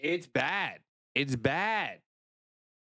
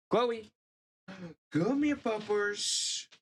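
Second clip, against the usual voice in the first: high sing-song call to the dog; 8 semitones higher than usual. Real, not acted. Streamer calls his dog Chloe over, high and coaxing. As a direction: coax the puppy over in a high voice.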